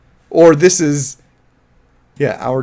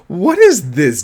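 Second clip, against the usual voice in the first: normal talking voice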